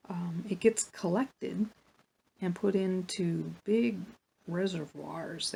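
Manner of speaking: ordinary delivery, nothing marked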